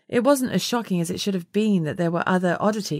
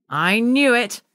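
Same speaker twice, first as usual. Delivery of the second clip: higher, louder triumphant crow; 5 semitones higher, 5 dB louder, slower than usual. A narrator's reading. Wilf gloats on the phone, crowing. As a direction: gloat that you guessed right. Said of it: "Wilf crowed".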